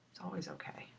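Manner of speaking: soft whispered reassurance. Real, not acted. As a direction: breathe it softly.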